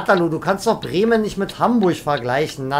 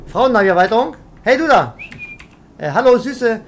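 loud, raised catcall; calling out; 3 semitones higher, 5 dB louder than usual. Pretend. Trucker to a woman on the street, calling out. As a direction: hail a passer-by from the cab.

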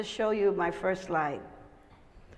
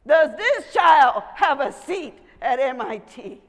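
slow, loud, emphatic demand; loud, insistent questioning; pointed challenge; 2 semitones higher, 8 dB louder than usual. Retold or imitated. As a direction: press the question hard and slowly. Challenging the dean in retold story.

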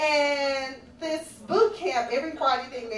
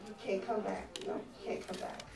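fading to a whisper; 4 semitones lower, 11 dB quieter than usual. Acted. Repeating it to the ex.

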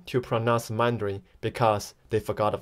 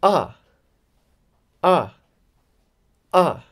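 AH dropped sharply from high; each short AH falls from a high start; falling tone; 4 semitones higher, 3 dB louder, slower than usual. Shown as a demonstration. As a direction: start AH high and drop it fast. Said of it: "we can call it a falling tone".

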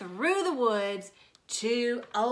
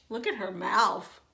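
usual speaking voice

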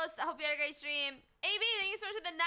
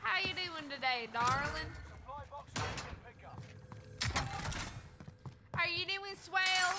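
put-on Southern accent; 3 dB quieter than usual. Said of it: "They was Southern right now".

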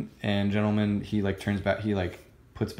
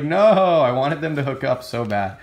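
higher, louder mock wail of disappointment; excited whine; 5 semitones higher, 6 dB louder than usual. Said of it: "And I was like".

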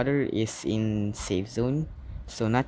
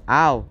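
pained yelp; 7 dB louder than usual. Real, not acted. Shark bites player in game.

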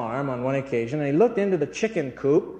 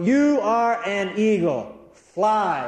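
slow, emphatic, higher-pitched command; urgent retold command; 4 semitones higher, much slower than usual. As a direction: urge it to take flight, word by weighty word. Retold command urging the eagle to fly.

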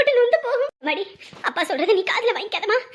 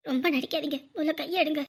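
cold rebuff; 5 semitones lower, 6 dB quieter than usual. Acted. Daughter rebuffs fake mother.

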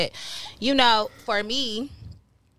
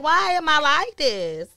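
high slow mock despair; 6 semitones higher, slower than usual. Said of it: "I'm like".

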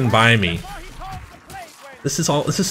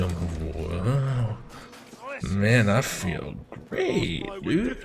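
put-on drunk slur; 3 semitones lower, 8 dB quieter, much slower than usual. Drunk bliss, slow.

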